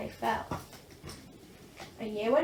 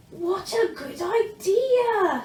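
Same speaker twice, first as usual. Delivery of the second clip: very slow, higher, louder; eager young dragon; Zog delighted; 8 semitones higher, 6 dB louder, much slower than usual. A narrator's reading. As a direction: stretch it out joyfully. Zog answers brightly.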